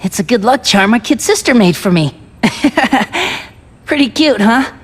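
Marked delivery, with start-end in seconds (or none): 2.4-3.4 a short, bright giggle of delight; happy little laugh. Acted. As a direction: giggle fondly over the cute charm. Shows sister's charm proudly.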